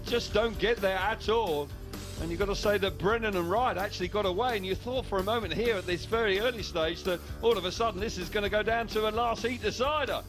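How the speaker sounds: ordinary delivery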